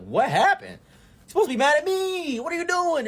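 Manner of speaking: shrill-free pitch jump of disbelief; incredulous high outburst. Retold or imitated.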